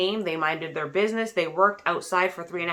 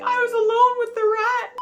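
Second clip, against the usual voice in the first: high whiny voice, a touch louder; daughter's wail; 4 dB louder than usual. Retold or imitated. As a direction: whine like a scared teenager. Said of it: "She's like".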